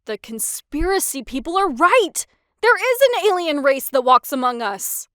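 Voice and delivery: high, loud thrilled outburst; loud, high and delighted; excited. A narrator's reading. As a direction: burst out with geeky glee. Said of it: "more excited than freaked out".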